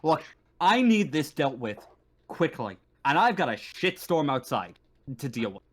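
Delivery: forceful, impatient officer with punched key words; stern, fed-up marshal. Acted. Marshal handing problem to visitors, sternly fed up. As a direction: lay down terms, fed up.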